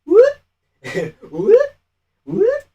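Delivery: very high rising whoop; squeaky mock dodging noise; comic whoops. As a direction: imitate a slip with a high whoop. Imitating fighter's clumsy moves.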